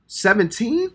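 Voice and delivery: high, rising shocked question; can't believe the age; stunned disbelief. Real, not acted. Reactor learns rapper's age.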